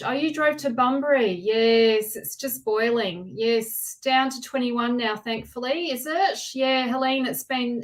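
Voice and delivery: normal talking voice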